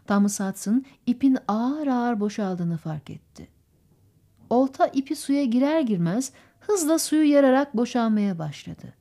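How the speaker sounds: ordinary delivery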